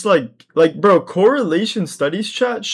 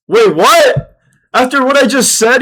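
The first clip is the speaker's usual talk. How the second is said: high, loud, outraged squeal; shocked; 9 semitones higher, 9 dB louder than usual. Real, not acted. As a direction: yelp in disbelief. Shocked at chat request.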